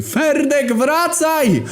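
very high, loud call after him; desperate yell. Acted. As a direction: scream his name to stop him. Calling friend back from stage.

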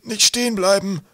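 high, loud urgent cry; urgent urging. A narrator's reading. Professor urging Twig not to stop, urgent cry. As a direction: call out to drive him onward.

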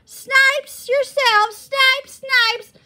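loud, high, drawn-out jeer; high-pitched mocking boy's yell. A narrator's reading. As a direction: yell it slowly as a schoolyard taunt. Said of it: "a freckled boy with fire-colored hair yelled".

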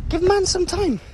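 high, exasperated protest; exasperated plea. Real, not acted. Rushed to dinner, begging the viewers for patience, high and exasperated. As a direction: throw it up high, pleading for patience.

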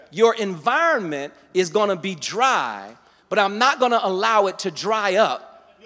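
loud, high-pitched preacher's delivery; impassioned sermon, punching the key words; full-force preaching. Real, not acted.

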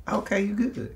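high, soft unbothered mom voice; imitated mom. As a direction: voice a mother lightly letting it go. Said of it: "my mom was like".

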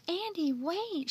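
high, drawn-out call; crying out. Acted. Mildred running down the walk to Andy, crying out. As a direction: call after him, stretching the words. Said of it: "she cried".

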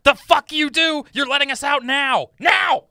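loud high furious shouting; angry yelling. Acted. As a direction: bark at the reckless driver. Passenger shouts demands.

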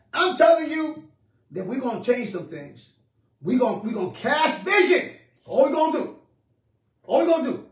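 prophesy over them with excitement; fired-up preaching. Real, not acted. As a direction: exhort the congregation, voice lifted. Preaches a vision to congregation.

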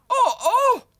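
very high, loud squeaky badger cry. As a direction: yelp like a scalded little badger. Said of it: "it cried out".

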